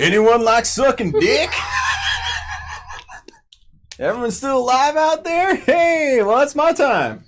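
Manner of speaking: high, loud, laughing comic's sign-off; mock stand-up closer. Comic parodies his own stand-up closer for the host, high and laughing. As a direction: ham up a cheesy club comic ending his set.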